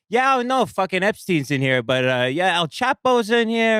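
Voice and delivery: high, loud goofy guard voice; mock guard. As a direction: squeal it like an overexcited guard. Imitating the prison guard.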